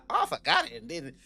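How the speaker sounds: high, laughing put-on voice of a lame excuse; mocking him in a goofy high voice, giggling. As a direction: ridicule his story by acting it out.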